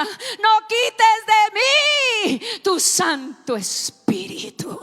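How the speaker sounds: loud, high, slowly stretched cry; shouted plea. Acted. David crying out to God, loud slow shouted plea. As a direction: drag out "No quites de mí tu Santo Espíritu" in a loud high shout. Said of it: "Y le grita".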